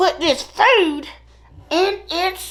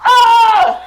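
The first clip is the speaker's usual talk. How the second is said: surprised shout; 6 semitones higher, 8 dB louder than usual. Retold or imitated. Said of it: "And he's like".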